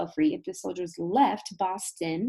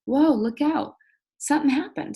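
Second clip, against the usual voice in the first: higher and louder, startled; sudden alarm; 7 semitones higher, 6 dB louder than usual. Shown as a demonstration. Teacher acts out sudden alarm at the signal, startled. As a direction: jolt the class with the surprise. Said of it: "that means, like".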